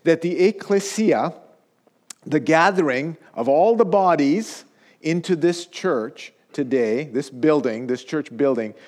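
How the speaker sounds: prayer. Acted. Pastor prays before the sermon.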